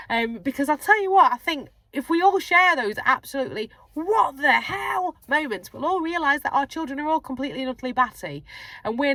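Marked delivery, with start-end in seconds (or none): 3.9-5.2 mock disbelief. Parent's exasperated reaction.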